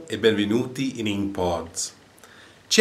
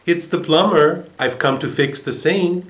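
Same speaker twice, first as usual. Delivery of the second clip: higher, raised voice of a workman; plumber at the door; 7 semitones higher, 4 dB louder than usual. A narrator's reading. Plumber answering the parrot through the door, raised voice. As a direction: announce yourself at the door. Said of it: "To which the man replied".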